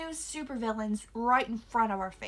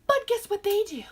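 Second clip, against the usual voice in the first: loud teaser; 8 semitones higher, 8 dB louder than usual. Real, not acted. Reviewer teasing her viewers about the movie's fake stunts, loud and high.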